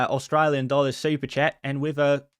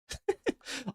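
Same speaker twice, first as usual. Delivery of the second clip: short laugh; 12 semitones higher, 8 dB quieter than usual. Real, not acted. Laughs at team mix-up.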